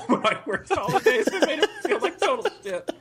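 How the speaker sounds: words squeezed high by laughter; mock yourself while cracking up; self-mocking laughter. Real, not acted. Laughs mocking own past behavior.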